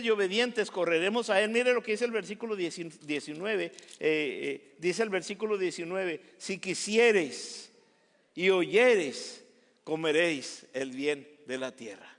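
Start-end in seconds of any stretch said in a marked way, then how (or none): 6.4-12.1 slow scripture reading. Acted. Lee el versículo lento.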